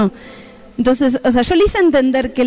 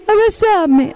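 put-on higher toddler voice; pleading little child; 7 semitones higher, much slower than usual. Littlest son asking mom for a hug, pleading. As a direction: imitate a small child begging for comfort. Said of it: "Y me dice".

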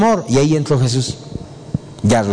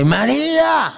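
high, drawn-out call of a name; high sing-out call, not shouted; 11 semitones higher, slower than usual. Acted. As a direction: call out to her, stretching the name. The Lord calls her name.

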